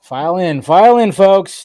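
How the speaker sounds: loud, rising call to the crowd. Real, not acted. Host calling viewers into the stream, loud welcome. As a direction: bellow them in like a barker.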